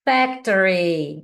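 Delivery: "Factory" drawn out slowly; teacher slowly pronouncing "Factory" for a check; slow model word. Shown as a demonstration. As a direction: stretch out "Factory" so they can check it. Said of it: "eu falo depois pra você checar".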